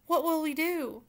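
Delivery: understated despairing question; mild lament. Acted. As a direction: ask it helplessly, first time. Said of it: "wailed Cook".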